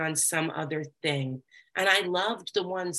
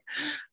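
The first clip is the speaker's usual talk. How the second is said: sharp dramatic gasp; theatrical gasp; 11 semitones higher, 6 dB quieter than usual. Retold or imitated. Overeater mocking her old self, a hammed-up gasp. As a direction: gasp in mock horror. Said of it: "I would be like".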